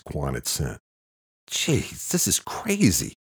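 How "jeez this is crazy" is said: high-pitched, startled disbelief; shocked outburst, pitched way up; blurted out. A narrator's reading. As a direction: blurt it out, rising to a loud question. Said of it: "Luke blurted".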